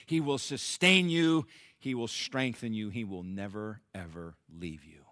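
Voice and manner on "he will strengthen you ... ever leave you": softer and softer, lower to a hush; fading reassurance. Real, not acted. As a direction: promise it gently, letting it fade.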